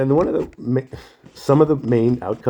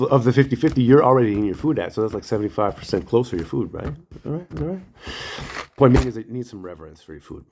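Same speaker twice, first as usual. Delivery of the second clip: ordinary talk, nothing marked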